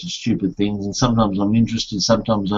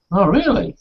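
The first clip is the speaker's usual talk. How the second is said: mock surprise; 8 semitones higher than usual. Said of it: "And I was going".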